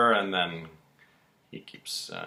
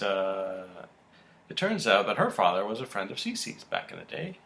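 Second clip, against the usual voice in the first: ordinary talk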